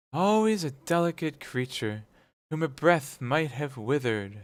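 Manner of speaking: ghost's voice. A narrator's reading.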